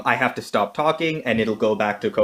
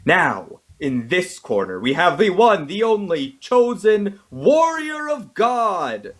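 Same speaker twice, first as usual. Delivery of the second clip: booming wrestler boasts; 8 semitones higher, slower than usual. Acted. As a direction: ring announcer building to a booming peak. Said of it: "I wanna do it in a wrestler voice".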